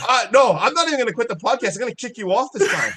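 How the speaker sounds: mock outrage. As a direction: shout it, laughing through the fake anger. Mock rage at co-host.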